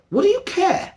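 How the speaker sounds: high, mock-baffled rhetorical question; incredulous what do you care. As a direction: toss it off as if it's obvious.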